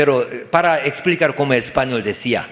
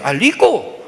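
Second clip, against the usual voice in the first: put-on higher-pitched child echoing "lico"; son repeating; 5 semitones higher, 3 dB louder than usual. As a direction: parrot the mistake back to dad. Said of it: "hijo dice".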